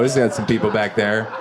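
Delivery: ordinary delivery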